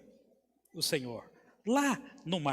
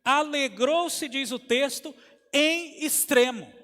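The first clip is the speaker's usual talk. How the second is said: raised, forceful preaching voice; pregação vigorosa; 5 semitones higher, 7 dB louder than usual. Acted. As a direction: preach it high and loud, punching key words. (pregação intensa, em voz elevada)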